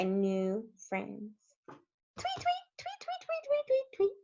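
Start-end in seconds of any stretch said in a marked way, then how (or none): 1.7-4.2 very high, soft string of chirps; squeaky birdsong imitation; birds tweeting. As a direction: chirp away like little birds.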